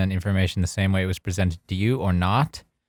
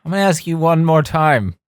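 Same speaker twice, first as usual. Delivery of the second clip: stern dad; 7 semitones higher, 4 dB louder than usual. Retold or imitated. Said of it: "He goes".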